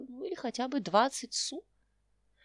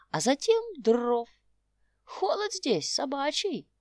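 put-on old prospector; 3 dB louder than usual. Old tramp explains plan.

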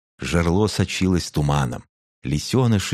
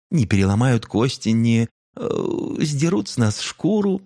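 much higher, hesitant cub voice; nervous young cub; 9 semitones higher than usual. A narrator's reading. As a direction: recite it high and nervous. Alpha's prepared speech.

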